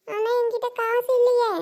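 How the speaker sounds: put-on high child's voice, hesitant to accept; little niece. Niece tells aunt she has no money, child voice. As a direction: object like a penniless little girl.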